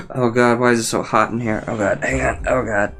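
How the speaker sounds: talking around a lip of dip; lip full of dip. Real, not acted. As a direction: mouth full of tobacco. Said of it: "you have to see my spit bottle".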